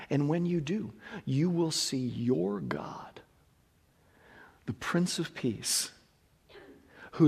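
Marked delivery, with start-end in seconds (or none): 0.1-5.9 whisper. Acted. Sermon climax hushed.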